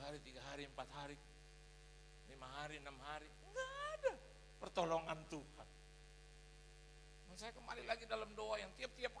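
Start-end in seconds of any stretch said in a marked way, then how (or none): none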